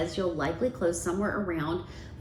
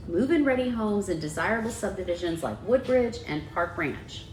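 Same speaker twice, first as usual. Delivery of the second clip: usual speaking voice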